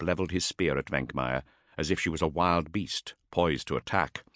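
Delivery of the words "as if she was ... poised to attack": ordinary delivery, nothing marked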